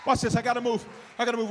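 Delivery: usual speaking voice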